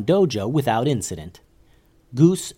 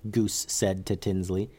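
usual speaking voice

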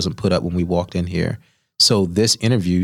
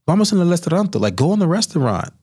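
insistent chef; 9 semitones higher than usual. Retold or imitated. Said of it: "she's like".

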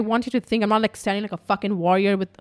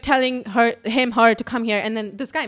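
ordinary talk, nothing marked

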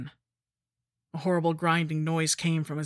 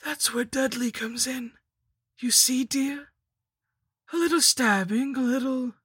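high, singular villainess relish; scheming lich, gleeful; 8 semitones higher, 4 dB louder than usual. A narrator's reading. Lich explaining the sacrifice to her captive, high and gleeful. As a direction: play up the condescending endearment in a high voice.

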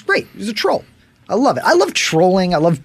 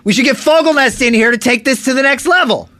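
Marin impression; 10 semitones higher, 4 dB louder than usual. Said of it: "What did that sound like?".

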